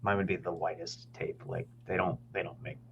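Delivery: usual speaking voice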